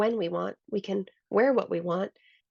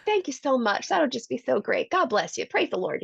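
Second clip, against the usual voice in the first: higher Southern thanks, a touch of a preacher; grateful Southern dad; 5 semitones higher than usual. Retold or imitated. Dad thanking the pizza guy, mild Southern accent. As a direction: gush thanks in Dad's Southern way. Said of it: "He has a Southern accent".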